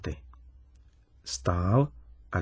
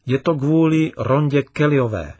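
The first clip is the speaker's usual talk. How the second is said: upset; 4 semitones higher, 8 dB louder than usual. A narrator's reading. Upset girl names the cause.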